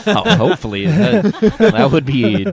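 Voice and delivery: laughing through the words; giggling while talking. Real, not acted. Laughing at the glowing walls.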